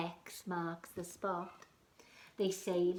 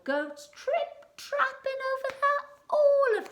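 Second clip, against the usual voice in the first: squeaky mouse; 12 dB louder than usual. Acted. Mouse sending troll away.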